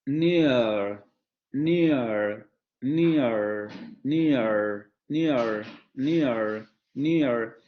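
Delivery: near drawn out with the glide and r, niar; historical near. Shown as a demonstration. Teacher showing how old English said near. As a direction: stretch near into nia-r, again and again. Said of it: "con ese diptongo al, eh, metido ahí".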